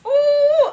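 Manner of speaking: very high, loud anguished wail; wailing "ooh". Real, not acted. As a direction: let out a sudden loud cry of dismay. Remembering where she was.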